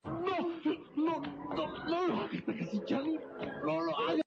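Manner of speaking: high, strained frantic cries; desperate pleading. Acted. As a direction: beg while being choked. Boy begging while being choked.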